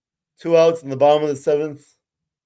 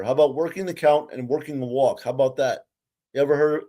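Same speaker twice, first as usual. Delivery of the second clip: ordinary talk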